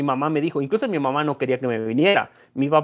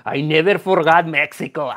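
teasing line in English, slow; English retort. Retold or imitated. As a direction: tease them in English to annoy them. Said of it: "para hacerlos enojar".

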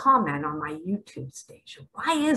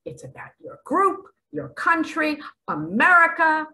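higher, building list ending loud; mounting indignation; rising emphasis; 9 semitones higher, 4 dB louder than usual. Real, not acted. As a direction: climb up to America. Mocking ego's priorities.

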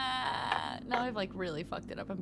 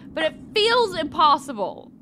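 exasperated; 7 semitones higher, 12 dB louder than usual. Real, not acted. Frustrated at puzzle.

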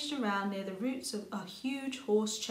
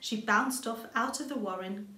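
ordinary delivery, nothing marked

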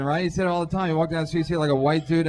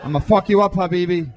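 mock threatening captain. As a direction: bellow a hammed-up threat over the intercom. Captain on steroids threatening terrorists.

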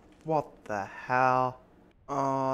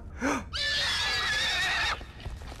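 panicked screams. Real, not acted. Monster chase screams.

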